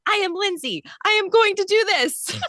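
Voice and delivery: high-pitched, determined movie-heroine declaration; Drew Barrymore. Acted. Heroine psyching herself up to run, mock-determined impersonation. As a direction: push it up high, hammed-up self-pep talk. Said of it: "You sounded like Drew".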